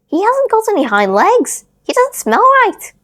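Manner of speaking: high, loud startled critter voice; exclaiming wild rabbit. A narrator's reading. Wild rabbit recoiling from the toy, high and loud. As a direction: squeak it out like a spooked rabbit. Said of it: "the wild rabbit exclaimed".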